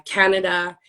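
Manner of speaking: ordinary talk, nothing marked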